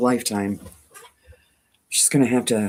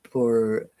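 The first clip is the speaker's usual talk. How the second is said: normal talking voice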